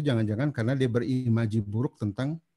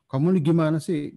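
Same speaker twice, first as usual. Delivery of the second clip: put-on sharp, raised scolding of a messy child; 6 semitones higher, 5 dB louder than usual. Parent scolding child over mess, raised exasperated voice. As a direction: snap at the kid in exasperation. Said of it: "atau kadang-kadang kita marahi".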